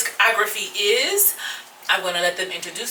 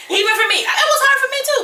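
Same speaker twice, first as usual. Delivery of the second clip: much higher, louder protest; high emphatic exclaim; 4 dB louder, much faster than usual. Real, not acted. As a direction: squeal it in mock despair.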